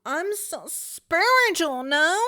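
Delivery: high, slow, airy-fairy mock voice; hammed-up smug spiritual voice; mock spiritual self. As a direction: parody your old enlightened self, dragging it out. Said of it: "I was like".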